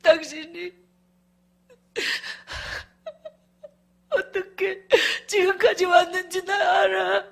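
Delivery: slow, halting tearful promise; imitated weeping plea. Husband promising wife a new life, tearful pleading. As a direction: beg through sobs. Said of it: "울고 있는 소리가 계속 들리는데".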